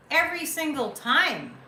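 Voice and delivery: "Every" punched loud and high; emphatic stress. Shown as a demonstration. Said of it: "I'm emphasizing".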